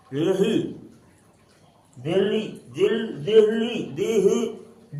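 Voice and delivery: दिल्ली broken up slowly, sliding towards "de-h-li"; English-style pronunciation. Shown as a demonstration. Teacher tries out how the English said it, दिल्ली stretched syllable by syllable. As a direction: sound out दिल्ली piece by piece as "deh-li". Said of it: "उनका pronunciation अलग था".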